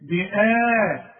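بآ held very long; long alif. Shown as a demonstration. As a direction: stretch the alif in بآ. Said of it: "تديها ألف كاملة".

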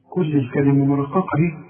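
ordinary talk

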